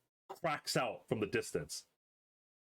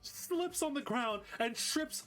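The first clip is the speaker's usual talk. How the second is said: excited play-by-play; 9 semitones higher than usual. A narrator's reading. Game master narrates Bernard tripping over the deer, excited. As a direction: stretch out the fall for comedy.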